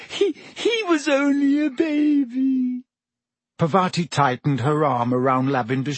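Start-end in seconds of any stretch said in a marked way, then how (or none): 0.1-2.8 very high, slow, stammering sob; sobbing. A narrator's reading. Lavender grieves her baby rabbit, high and sobbing. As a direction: wail it through hammed-up sobs. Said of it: "sobbed Lavender".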